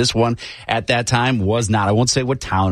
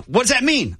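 imitated irritated cop, much higher; angry cop; 12 semitones higher than usual. Cop challenges the driver's answer, mock irritated and high. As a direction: snap back like an impatient officer. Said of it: "he was so angry".

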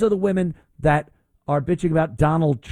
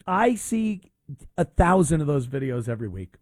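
ordinary delivery

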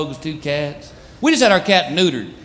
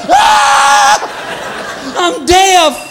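much higher, shouting, slow at the end; mock deaf panic; 12 semitones higher, much slower than usual. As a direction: yell like you've lost your hearing. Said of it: "You're like".

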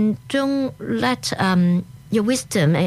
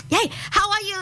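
much higher, excited hello; high-pitched mock party greeting; over-excited greeting; 11 semitones higher, faster than usual. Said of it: "too excited".

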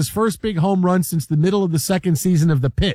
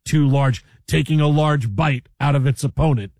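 lightly lowered, hammed-up broadcaster call; mock play-by-play; 5 semitones lower than usual. Joking to cohost about animal videos, mock sportscaster call. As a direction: call the hippo fight like a game.

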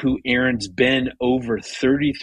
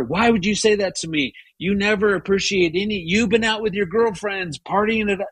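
higher, fast accusing; imagined angry retort; 8 semitones higher, faster than usual. Retold or imitated. Husband imagining a fight with wife, fast higher accusation. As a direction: fire back the accusation quickly, higher. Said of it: "gone with my gut".

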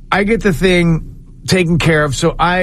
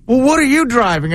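imitating Bill; 7 semitones higher than usual. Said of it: "He goes".

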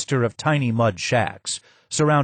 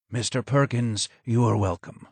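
low and hoarse; 3 dB quieter than usual. Acted. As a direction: greet him in a worn rasp. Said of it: "was low and hoarse".

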